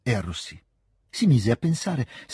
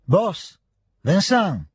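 higher, moderately raised call; 8 semitones higher, 4 dB louder, much slower than usual. A narrator's reading. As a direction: call a name out into the dark. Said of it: "si mise a gridare Petia". (richiamo a voce alta)

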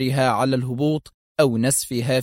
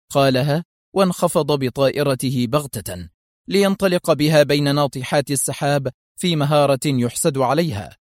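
usual speaking voice

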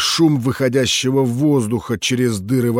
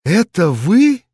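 surprised; 6 semitones higher, 4 dB louder, slower than usual. Acted. Liza recognizes the general.

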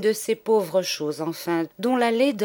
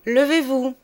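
stern order; 7 semitones higher, 6 dB louder than usual. Acted. Teacher orders new boy to stand.

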